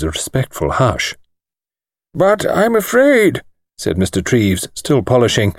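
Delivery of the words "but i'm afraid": put-on higher, slow old-man voice, musing; an elderly lawyer weighing his words slowly; thin precise voice. A narrator's reading. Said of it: "thin, precise little voice".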